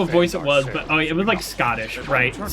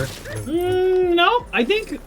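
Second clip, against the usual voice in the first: playful disagreement; much slower than usual. Real, not acted. Playfully disputes chat's rule.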